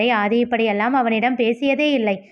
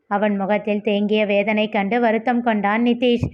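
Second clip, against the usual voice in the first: ordinary delivery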